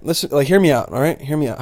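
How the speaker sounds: ordinary talk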